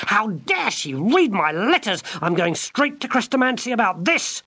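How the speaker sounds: put-on outraged girl. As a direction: storm off in outrage. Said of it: "said Gwendolen".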